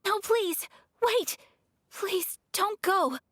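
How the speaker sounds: put-on a young lady crying out to stop departing guests; pleading call. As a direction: call after them desperately not to leave. Ayaka begs them not to leave.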